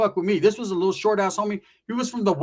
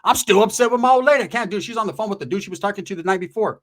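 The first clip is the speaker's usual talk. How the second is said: ordinary talk, nothing marked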